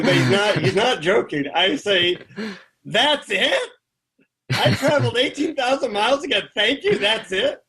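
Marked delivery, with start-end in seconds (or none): 2.8-7.6 high-pitched disbelieving retort; incredulous. Retold or imitated. Traveler protests to the guru about his long trip, incredulous. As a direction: protest in disbelief, pitch jumping up at each question.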